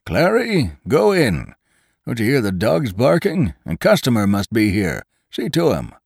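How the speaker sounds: put-on old man calling across the yard, high. A narrator's reading. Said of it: "the old man called out".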